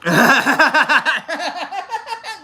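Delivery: high bursting laugh; real laugh. Real, not acted. Laughing at chat.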